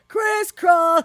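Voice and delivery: high, loud called-out instruction; dance caller. Retold or imitated. Imitating the cha-cha slide caller shouting a step. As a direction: call it out like the DJ. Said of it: "they're like".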